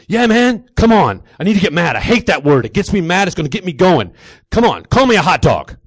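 loud, high, worked-up urging; frantic gym psych-up; amped-up demand. Acted. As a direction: egg your buddy on at a shout. Lifter pleads to be insulted.

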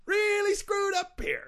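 high, slow silly voice. Pretend. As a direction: say it in a high goofy whine. Mocking himself in silly voice.

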